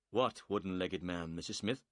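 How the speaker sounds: ordinary delivery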